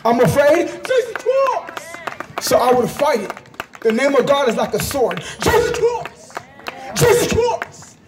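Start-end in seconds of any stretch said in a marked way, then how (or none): 0.7-2.0 high, long-held cry; sudden high, stretched scream; demonstrated scream. Shown as a demonstration. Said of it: "just scream that name". 5.4-8.0 high repeated cry; two high, ringing screams; demonstrated scream. Shown as a demonstration. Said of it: "just scream that name".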